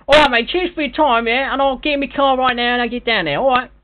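cheery Cockney geezer signing off; put-on London bloke saying rude goodbyes; London accent. Prank caller in put-on London voice.

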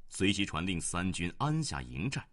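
ordinary delivery

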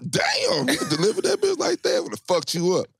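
high, excited wail of amazement; amazed. Retold or imitated. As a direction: gush like you're blown away. Imagined listener reacting to pitch.

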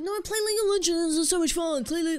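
high, loud mocking friend voice; whiny imitation of League-obsessed friends; mocking League fans. Mocking friends pushing her to play League. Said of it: "and that's how they spoke too".

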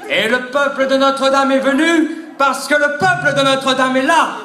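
hammed-up presidential oratory; mock rally speech. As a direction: boom it out to the crowd of supporters.

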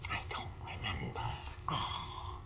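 very low, hushed whisper; barely audible aside. Real, not acted. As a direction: whisper it, lost for the words. Lost for words in therapy role-play.

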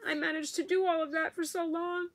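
pushing the words out through a sob; crying. Real, not acted. Recalling lost volunteer work.

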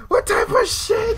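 high incredulous question; outraged disbelief. Real, not acted. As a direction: squeal it in disbelief. Disbelief at game.

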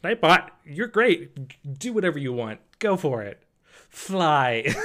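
carefree cheer. Retold or imitated. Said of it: "I was just like".

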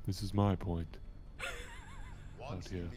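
low, quiet solemn captain voice; solemn pirate captain. Pretend. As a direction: speak like a grave old captain. Mock solemn captain roleplay.

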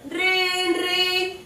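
high, sustained trilled ringtone; shrill-pitched held ring noise; imitated phone ring. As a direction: sing out a phone ringing.

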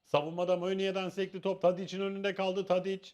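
steady live match commentary; play-by-play commentary. Acted. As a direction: call the build-up play by play. (maç anlatımı)

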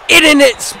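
loud, high burst; excited play-by-play. Real, not acted. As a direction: blurt out the defensive stop. Calling a broken-up screen pass.

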